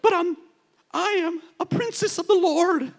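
high, prissy protest; entitled princess. Pretend. Pastor mocking a believer claiming special status, high and prissy. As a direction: play the pampered princess of the Lord.

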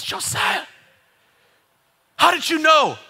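high-pitched, distraught retelling of a caller; imitated crying caller. Broker phoning in the middle of the night, imitated crying. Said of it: "He's crying".

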